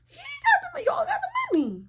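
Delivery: very high, squeaky mock voice; silly high-pitched squeak; playful squeal. Mock squeal about who pays.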